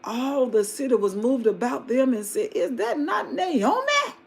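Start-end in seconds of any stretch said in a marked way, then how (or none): 2.5-4.1 much higher, slow astonished question; astonished townsfolk. Acted. Townspeople recognising Naomi, astonished. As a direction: gasp out the recognition.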